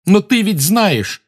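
high, loud slow shout. Acted. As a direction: shout at the elf. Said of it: "закричал Гарри".

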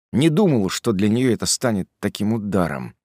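ordinary delivery

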